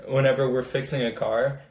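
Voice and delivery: normal talking voice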